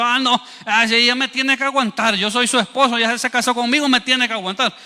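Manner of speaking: entitled husband. Acted. Husband sure his wife must endure him, mock entitled. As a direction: argue it as a presumptuous husband.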